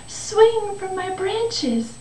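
high, delighted invitation, slowing on the swing; joyful. Acted. The tree invites the boy to play, joyfully. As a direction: coax eagerly in a high, happy voice. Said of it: "tree shook with joy".

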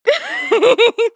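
high bright burst of laughter; real amused laugh. Real, not acted. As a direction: laugh out at the absurd text. Laughs at soul siblings text.

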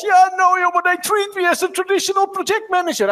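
mock the complaining colleague with a whiny high voice; whiny coach imitating a complaint on the phone; whining coach. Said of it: "one of those whining calls".

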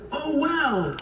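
lightly voiced woman crying out in pity; mild cry. A narrator's reading. Tender woman finding the frozen snake, mild pitying cry. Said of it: "she cried".

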